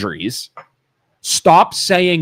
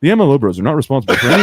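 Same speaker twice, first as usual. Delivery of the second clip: rattled-off mock ad disclaimer; real quick disclaimer; 3 semitones lower, much faster than usual. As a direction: race through the small print in one rush. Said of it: "do the real quick, like".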